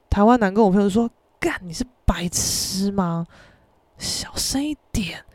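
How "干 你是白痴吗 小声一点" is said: hissed, slow whisper; slow urgent whisper, telling her off; whispered scolding. Retold or imitated. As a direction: scold her under your breath so nobody hears. Scolding friend to keep quiet.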